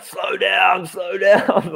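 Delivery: high, shouted crowd chant; crowd shouting. Retold or imitated. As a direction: yell it like a roadside crowd. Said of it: "the whole crowd were going on".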